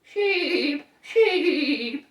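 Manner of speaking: high bleating "Sheep!"; sheep baa. Pretend. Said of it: "Or a sheep".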